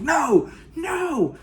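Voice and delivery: high panicked shout of no. Retold or imitated. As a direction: yell it high as the cuffs catch. Cuffed to Swayze, panicking.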